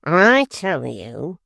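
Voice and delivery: very slow, lofty declaiming; pompous apprentice. A narrator's reading. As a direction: make every word weighty. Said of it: "said the prentice".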